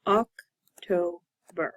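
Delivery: OCTOBER very slow, oc... to... ber; three syllables. Shown as a demonstration. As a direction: clear pause between each syllable of OCTOBER. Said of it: "Three syllables".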